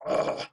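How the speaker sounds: exasperated sigh. Acted. Exasperated after the rant.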